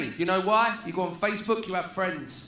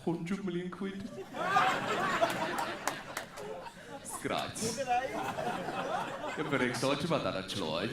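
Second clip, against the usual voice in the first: flat West Midlands; 2 semitones lower, 8 dB quieter than usual. Retold or imitated. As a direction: sound like nothing could ever thrill you. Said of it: "the press conference goes like this".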